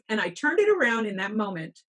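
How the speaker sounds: ordinary talk, nothing marked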